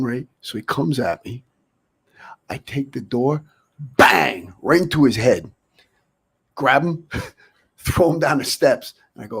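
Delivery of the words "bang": sudden loud "bang"; explosive storytelling sound effect; loud impact imitation. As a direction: punch it out like a door slamming. Imitates door hitting the head.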